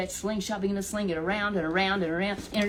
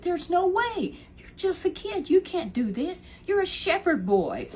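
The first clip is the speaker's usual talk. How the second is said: Saul scolding; 10 semitones higher than usual. Retold or imitated. Saul scolding David, voiced high.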